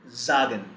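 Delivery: "sagen" with buzzed z onset. Shown as a demonstration. Teacher repeats an example to students, "sagen" with initial z. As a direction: say "sagen" as ZAH-gen. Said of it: "we pronounce it as z".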